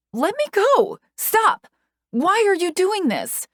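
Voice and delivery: high, loud frightened scream; terrified scream. A narrator's reading. Screaming at Patricia while dragged away, terrified. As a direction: scream for your life.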